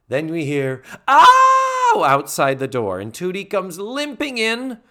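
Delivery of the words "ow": sharp high mock yelp of pain. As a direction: yelp "Ow!" like you just got hit. Tootie hit by bike, crying out.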